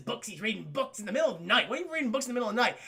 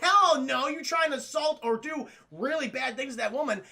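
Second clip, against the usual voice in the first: mock heroic retort; 6 semitones higher, 4 dB louder than usual. Spider-Man voiced defiant.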